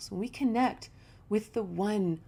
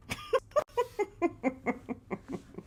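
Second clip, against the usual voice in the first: amused laugh; 10 semitones higher than usual. Real, not acted.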